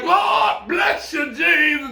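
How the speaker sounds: preacher bellowing a blessing; mock preacher. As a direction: parody a shouting preacher. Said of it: "He don't be like".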